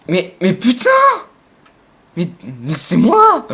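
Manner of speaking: loud, slowed indignant outburst; raging gamer. Real, not acted. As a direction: protest loudly, drawing out moi. Gamer outraged at an attacker.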